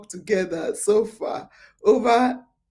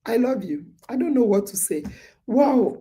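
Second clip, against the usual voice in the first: ordinary delivery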